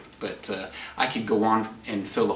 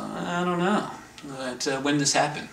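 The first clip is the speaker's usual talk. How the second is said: higher Joe voice; 6 semitones higher than usual. Retold or imitated. Voicing Joe questioning him.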